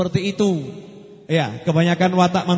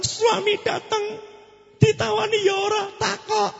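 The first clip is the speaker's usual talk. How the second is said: mock outrage; 13 semitones higher than usual. Emphatic complaint about husband.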